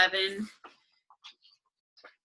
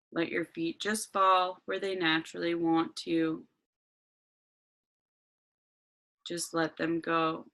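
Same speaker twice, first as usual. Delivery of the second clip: guided meditation. Acted.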